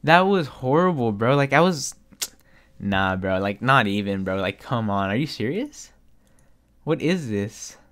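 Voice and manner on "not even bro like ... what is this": normal talking voice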